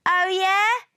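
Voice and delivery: loud, high retort; challenging shout. A narrator's reading. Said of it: "shouted Mars".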